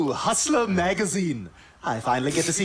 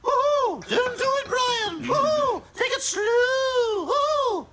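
whooping cheers; slower than usual. Acted. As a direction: cheer him on like a rowdy fan. Cheering Brian on from outside.

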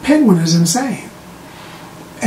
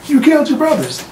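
higher-pitched mock accusing voice; acted accusation; 8 semitones higher, faster than usual. Everybody in the room confronts the Penguin, accusing. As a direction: confront him, pitched well up.

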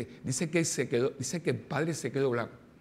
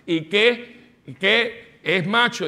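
louder, higher, pushy demand; demanding father; 6 semitones higher, 7 dB louder, much slower than usual. Retold or imitated. Father demands to know if his son is macho, loud. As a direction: bark out the question eagerly.

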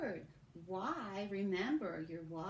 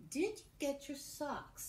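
slow dictation; 6 semitones higher than usual. Shown as a demonstration.

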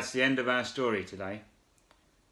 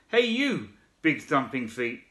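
high calling shout from the frog; 10 semitones higher than usual. Acted. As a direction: call out brightly to a stranger. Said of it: "shouted the big wide-mouthed frog".

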